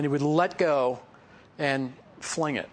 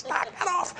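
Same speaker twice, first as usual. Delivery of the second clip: a mother calling out to stop the noise; mom yelling. Retold or imitated. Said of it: "my mom would yell".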